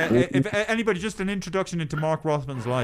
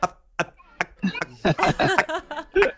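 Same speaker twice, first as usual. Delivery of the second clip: high, choppy bad-reception voice; breaking up phone line; 14 semitones higher, much slower than usual. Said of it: "this is what you'll hear".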